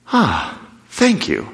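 slow relieved sigh of thanks; relieved calm. Shown as a demonstration. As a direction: let the anger drain out slowly. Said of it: "Just like this".

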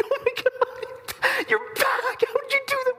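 overjoyed disbelief. Acted. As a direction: burst out in delighted disbelief. Mary overjoyed seeing Jesus back.

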